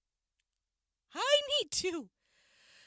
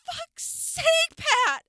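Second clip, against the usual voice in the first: loud, desperate outburst; exasperated shout; 8 semitones higher, 5 dB louder, slower than usual. Acted. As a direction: yell it, out of patience. Finally blurts out her need.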